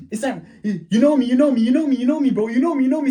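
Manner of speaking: high-pitched, repetitive begging; acted-out flustered friend; panicked pleading. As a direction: babble urgently to be recognised. Friend pleads with angry dad.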